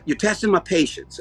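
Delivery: normal talking voice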